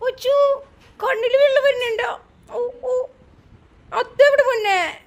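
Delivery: high cooing baby talk, mock pity; cooing sympathy. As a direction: coo at the little one as if he's heartbroken. Mother mock-pities crying toddler.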